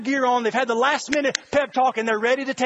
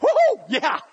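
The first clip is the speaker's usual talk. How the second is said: high-pitched football cheer; excited whoop; 8 semitones higher, 3 dB louder than usual. Acted. Churchgoer hearing football is near, high excited whoop. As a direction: whoop it up like a fan. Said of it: "gets you a little excited".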